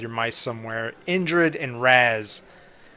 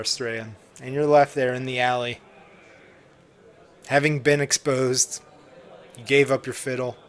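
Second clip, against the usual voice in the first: story narration. A narrator's reading. GM narrates player's defeat.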